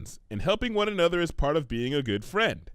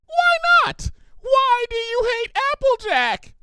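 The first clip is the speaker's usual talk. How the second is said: much higher, louder, slow pleading; frantic outraged squeal; 6 dB louder, slower than usual. Acted. Pinkie grips Twilight's face, frantic squeal. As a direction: demand an answer.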